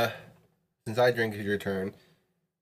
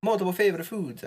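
high, sing-song mock Italian accent; 8 semitones higher than usual. Player protesting the rule to a friend in a mock Italian accent. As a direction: put on a hammy Italian accent, pitched well up. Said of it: "speak with an Italian accent".

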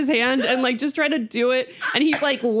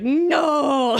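imitated toddler's drawn-out "no"; mock defiant shout, stretched out; toddler refusal; 2 semitones higher than usual. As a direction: blurt a long toddler-style refusal. Acted toddler refusing, then throwing.

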